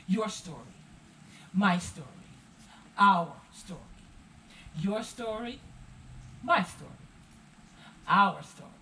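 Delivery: poem recitation. Acted.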